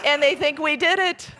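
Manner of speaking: put-on high, loud child's punchline; alarmed little boy. Scared boy's punchline to brother.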